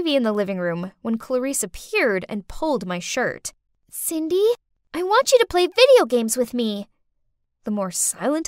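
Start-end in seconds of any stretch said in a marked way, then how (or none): 3.9-6.9 put-on pestering little girl.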